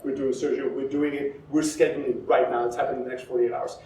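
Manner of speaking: low, soft, decisive doctor; quiet authority. Acted. Doctor scheduling life-or-death surgery, quietly authoritative. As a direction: lay out orders with calm finality. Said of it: "has that level of authority".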